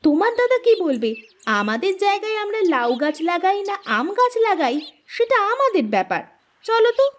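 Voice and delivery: defiant retort. Acted. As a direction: brush off the brother-in-law. Defiant about the elder brother.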